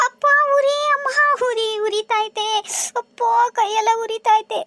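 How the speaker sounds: high wail easing into a slow moan; moaning in pain. Acted. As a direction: whimper about the burning hand. Burned hand, moaning.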